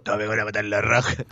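put-on low, fast crude old man voice. Shown as a demonstration. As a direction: growl it like a raunchy old man. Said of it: "Y se lo digo con esa voz".

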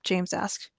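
usual speaking voice

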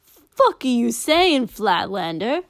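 loud, slow furious shout; angry yell. Acted. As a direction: bark the question at him. Warrior yells mid-fight.

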